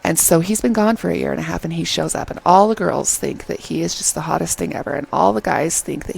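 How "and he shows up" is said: ordinary talk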